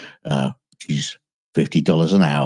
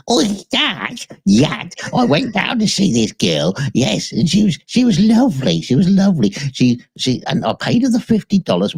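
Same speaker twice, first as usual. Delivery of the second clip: high-pitched dopey mock voice of a friend; gushing high mock voice of the enforcer; squeaky Enforcer Matt; 12 semitones higher than usual. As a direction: pitch it way up like a giddy goofball. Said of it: "and he said".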